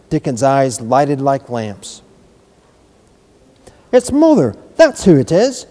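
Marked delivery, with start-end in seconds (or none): 3.9-5.7 high, loud joyful cry; excited cry. A narrator's reading. Dickon sees his mother arrive, crying out with joy. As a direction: call out with delight. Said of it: "he cried".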